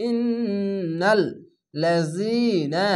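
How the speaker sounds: slow, drawn-out melodic chant; Quran recitation. Shown as a demonstration. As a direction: intone it like tajweed recitation. Tajweed recitation of Quran words. (ধীর সুরেলা কুরআন তিলাওয়াত)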